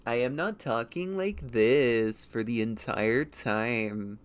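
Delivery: slow, drawn-out YouTube narrator voice; YouTuber voice. Shown as a demonstration. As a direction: stretch every word like a video essay. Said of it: "fake-ass YouTube accent".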